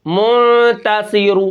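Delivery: منتصر slowly, last vowel barely voiced; recited with rawm. Shown as a demonstration. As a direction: draw out منتصر and whisper its final vowel. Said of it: "میں زیادہ آواز نکال رہا ہوں". (منتصر آہستہ، آخری حرکت خفیف سی)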